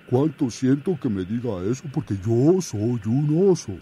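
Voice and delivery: oso firme y sencillo; bear folk. Acted. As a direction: explica con calma que es oso. Oso se disculpa. (oso que protesta)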